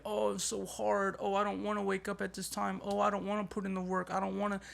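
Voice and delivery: hammed-up self-pitying moan; mock crying whine. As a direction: whine like a quitter about to cry. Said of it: "start crying and be like".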